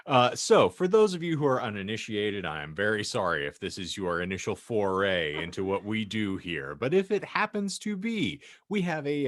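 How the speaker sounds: ordinary talk, nothing marked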